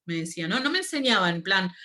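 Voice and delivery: usual speaking voice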